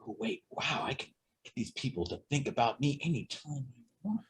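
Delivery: scheming whisper. Retold or imitated. Child realizing he can manipulate adults, whispered. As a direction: whisper it like a secret plan.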